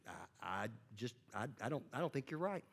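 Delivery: very soft, hesitant stammering; timid stammer. Pretend. Nervous student stammers disagreement at the professor, very soft. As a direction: barely dare to say it.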